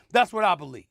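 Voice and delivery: ordinary delivery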